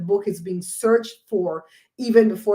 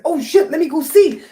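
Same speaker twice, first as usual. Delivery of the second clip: much higher and louder startled cry; startled exclaim; 8 semitones higher, 8 dB louder than usual. Retold or imitated. Vlogger retelling her reaction, high and loud. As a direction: jump into action. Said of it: "And I said".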